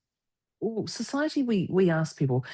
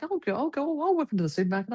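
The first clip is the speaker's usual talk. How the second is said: higher-pitched eager offer, settling at the end; eager volunteering; 7 semitones higher, faster than usual. Retold or imitated. Offering to go to the supermarket for partner, excitedly. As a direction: act out jumping at the chance. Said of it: "I get so excited in the supermarket".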